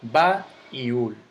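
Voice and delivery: "ba y ul" as two slow, separate beats; syllable by syllable. Shown as a demonstration. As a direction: hit "ba" and "ul" apart, loud and slow. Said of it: "hay dos golpes de voz".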